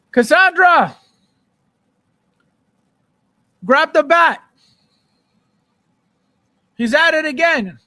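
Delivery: loud, high shout into the next room; mock call out. Streamer jokingly shouts to Cassandra about a trolling chatter, loud mock yell. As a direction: yell for backup, half joking.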